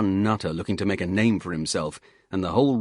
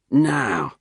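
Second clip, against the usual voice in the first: louder, higher soothing Cockney voice; calm the soldier down, Cockney style; placating Cockney hiker; 4 semitones higher, 5 dB louder than usual. Acted. Hiker calms the angry instructor in a Cockney voice.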